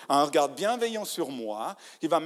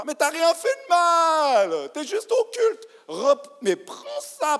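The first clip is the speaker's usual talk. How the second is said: much higher and louder, slow and pleading; fervent preaching shout; 12 semitones higher, 9 dB louder, slower than usual. Real, not acted. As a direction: cry it out to lift their shame. Preacher exhorting the ashamed believer. (crié avec ferveur au fidèle honteux)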